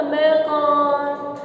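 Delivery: very slow, drawn-out trance reply; hypnotized. Acted. Hypnotized assistant answering the magician, entranced drawl. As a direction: stretch it out as if under a spell. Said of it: "इसने सम्मोहित कर दिया लिटा के".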